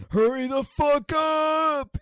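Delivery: screamed high and loud, drawn out; retold angry yell. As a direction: yell it like a furious guy at the bathroom door. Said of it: "just screaming".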